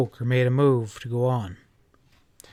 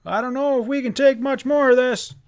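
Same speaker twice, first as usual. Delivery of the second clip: loud raised call over the storm; shouting above wind; 10 semitones higher, 3 dB louder than usual. A narrator's reading. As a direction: shout so you're heard over the gale. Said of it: "Pa shouted above the wind".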